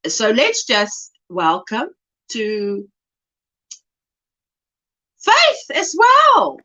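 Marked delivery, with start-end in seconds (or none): 5.2-6.6 excited. Real, not acted. Announcing another gifted membership, high and excited. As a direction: call it out like happy news.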